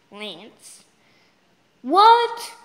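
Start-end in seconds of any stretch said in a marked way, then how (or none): 1.8-2.5 high, loud incredulous cry; shocked disbelief. Real, not acted. Child gamer seeing the game fail, shocked outburst.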